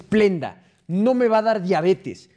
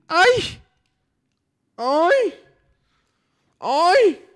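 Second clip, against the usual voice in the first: much higher, louder hammed-up moan; mock moan; 12 semitones higher, 4 dB louder, much slower than usual. Viewer asks streamer to moan, hammed-up high moan. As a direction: fake a silly moan on request. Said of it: "quiere que gimas".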